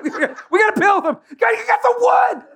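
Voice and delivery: high laughing shouts, giddy and loud; hammed-up frantic Peter. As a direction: yell orders through laughter. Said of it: "You know what I mean?".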